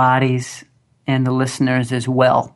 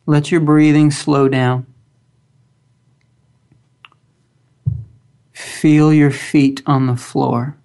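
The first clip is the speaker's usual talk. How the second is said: slow, calm guided meditation voice; grounding exercise; slower than usual. Acted. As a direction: guide them gently into stillness, long pauses.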